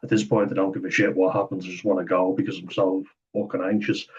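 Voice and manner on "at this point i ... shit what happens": normal talking voice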